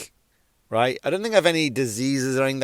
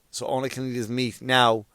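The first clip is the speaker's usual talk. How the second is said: normal talking voice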